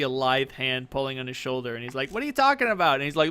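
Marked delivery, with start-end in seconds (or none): 2.1-2.9 high-pitched acted disbelief; incredulous retort. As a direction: call back in disbelief from a window. Hobgoblin shouts from window.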